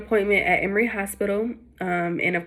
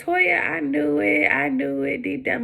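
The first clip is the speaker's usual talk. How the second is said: much higher, slow knowing mom; 7 semitones higher, slower than usual. Retold or imitated. Mom answering the twin news, high and slow knowing voice. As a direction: say it like a mother who saw it coming.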